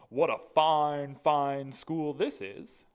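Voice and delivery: loud, slow, proud school principal; booming headmaster. Acted. Mister Keene's voice.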